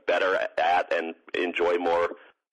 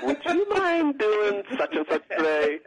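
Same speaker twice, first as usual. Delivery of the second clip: high, slow pleading whine; whiny voice; 12 semitones higher, slower than usual. Retold or imitated. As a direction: wheedle like a whiny kid. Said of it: "my little, uh, whiny voice".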